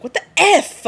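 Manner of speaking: high, loud what the F; shocked. Pretend. The Volturi seeing vampire chaos, loud shocked outburst. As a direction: blurt it out in mock shock. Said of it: "the Volturi would be like".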